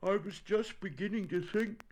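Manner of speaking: high, plaintive bear voice; slightly sniffly. A narrator's reading. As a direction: pitch it high, a touch tearful. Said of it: "sniffing slightly".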